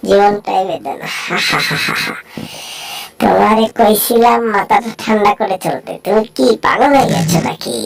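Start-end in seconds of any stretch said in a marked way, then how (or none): 1.2-2.1 soft airy cackling laugh; breathless cackle. Acted. Cartoon character gloating over the trap, airy cackle. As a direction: chuckle under the breath.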